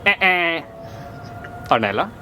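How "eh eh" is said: wrong answer buzzer. Pretend. Wrong answer given in the quiz.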